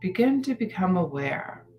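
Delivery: unhurried guided-meditation delivery with long pauses; calm, measured phrases, letting each one settle. Acted.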